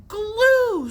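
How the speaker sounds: scream. A narrator's reading. Billy screaming at the cheats, shrill sudden scream. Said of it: "screamed Billy".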